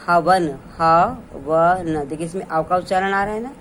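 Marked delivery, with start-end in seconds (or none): 0.1-2.1 हवन said very slowly and louder, twice; slow, deliberate हवन; slow demo. Shown as a demonstration. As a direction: draw out हवन syllable by syllable for the class. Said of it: "तो क्या बना हमारा शब्द".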